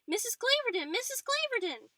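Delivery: high, loud calls for Frances; screaming a name. A narrator's reading. Patsy calling her housekeeper from bed, high loud screams. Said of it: "Patsy sat up and screamed for Frances".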